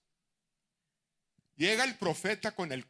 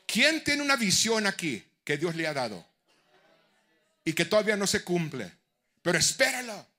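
preaching at full force; 2 semitones higher, 6 dB louder than usual. Acted. Preacher shouting encouragement to the congregation, at full force. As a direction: drive it home to the congregation.

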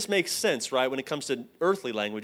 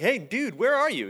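higher, upbeat where-are-you call; casual hey-dude call to a friend who's late; friendly call, pitched up; 8 semitones higher than usual. Retold or imitated.

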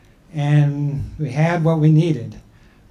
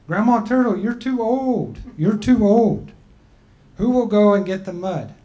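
a higher, slightly raised voice for the people; people's voice; 6 semitones higher, 3 dB louder than usual. Acted. The people telling Grandma Turtle she's too old, in a raised voice.

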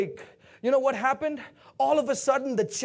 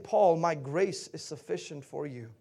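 low, soft, measured reassurance; quiet, settled authority; calm divine answer; 6 semitones lower, 6 dB quieter than usual. Acted. Voices God's calm answer to Paul.

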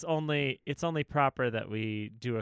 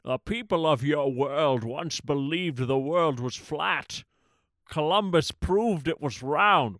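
Connery voice; 3 dB louder, slower than usual. Pretend. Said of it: "Sybok a la Connery".

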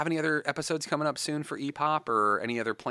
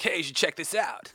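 higher-pitched, playful mock voice; silly put-on voice; 6 semitones higher than usual. Host imagining tagging her in the post, silly put-on voice. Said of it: "why I just did that voice".

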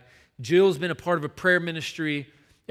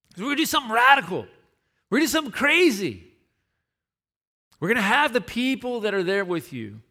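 retold rousing call; 4 semitones higher, 4 dB louder than usual. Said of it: "It's like".

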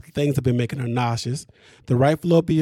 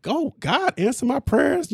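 a hint of a pleading woman, higher; lightly voiced thanks to God, pitched up; mock grateful prayer; 10 semitones higher, slower than usual. Retelling Safari's ex thanking God, mock prayerful. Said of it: "She was like".

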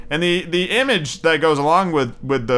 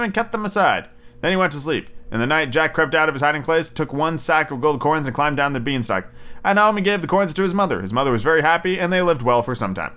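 ordinary delivery

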